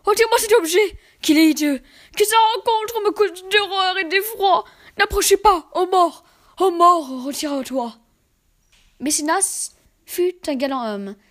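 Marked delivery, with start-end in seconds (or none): 0.1-8.0 high, loud panicked pleas; terrified pleading. Acted. Man begging death to leave, loud terrified pleading. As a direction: push death away in terror. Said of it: "cria-t-il".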